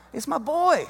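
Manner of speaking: usual speaking voice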